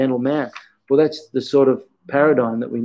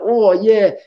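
higher, gleeful mock "oh yeah"; playful old master; 8 semitones higher, 4 dB louder than usual. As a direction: relish the pain you cause, lightly. Imitating teacher applying a hold.

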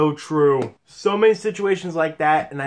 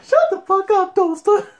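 excited repeat; 13 semitones higher, 4 dB louder than usual. Real, not acted. Repeating the line, laughing.

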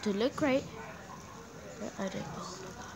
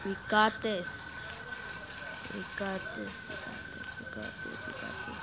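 soft, low chant of courage; hushed reassurance; 3 semitones lower, 9 dB quieter than usual. Real, not acted. Gamer hiding from the monster, whispering to herself. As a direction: whisper it over and over to steady yourself.

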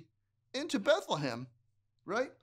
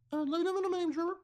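mermaid voice; 11 semitones higher than usual. Acted. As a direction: sing it sweetly like a mermaid. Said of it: "A mermaid voice".

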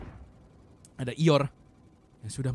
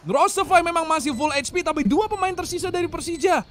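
slow, emphatic, higher; dramatic announcer; 11 semitones higher, 5 dB louder, slower than usual. Acted. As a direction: savour each word of the clutch. Slow dramatic clutch call.

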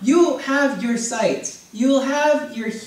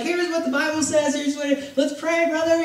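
mock zealous churchgoer; 6 semitones higher than usual. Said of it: "You're like".

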